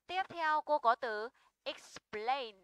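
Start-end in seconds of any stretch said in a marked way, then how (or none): 1.6-2.6 "explain" stressed on PLAIN; slow model of "explain", leaning on the second syllable; stress on diphthong. Shown as a demonstration. Teacher gives next example word, stressing the diphthong. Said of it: "Trọng âm sẽ rơi vào âm đôi".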